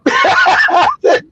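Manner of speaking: high laugh over the words; cracking up. Real, not acted. Laughing before quote.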